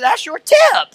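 high, loud, put-on teasing voice; teasing joke voice. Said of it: "I was gonna kinda tease the waitress".

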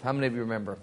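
dropped low and soft, coaxing an answer; soft low nudge to the congregation. Shown as a demonstration.

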